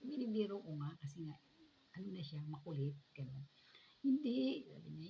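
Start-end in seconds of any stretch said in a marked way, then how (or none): 4.0-4.6 high, loud quick denial; grandma's protest. Retold or imitated. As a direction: blurt out the no, higher and louder. Grandma protesting she loves her. (malakas at mataas na pagtanggi ng lola)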